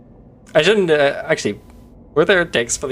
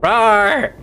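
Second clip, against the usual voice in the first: playful higher, louder roar; mock dinosaur roar; 5 semitones higher, 4 dB louder than usual. Streamer roaring as the pet dinosaur, hammed-up.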